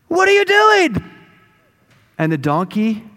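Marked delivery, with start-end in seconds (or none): 0.1-1.0 high, loud, exasperated yell; angry shout. Acted. Balaam to the donkey that lay down, angry shout. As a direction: yell at a stubborn animal in outrage.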